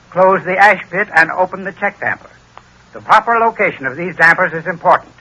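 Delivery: ordinary talk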